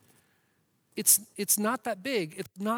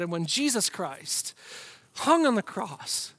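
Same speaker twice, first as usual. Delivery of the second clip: ordinary talk, nothing marked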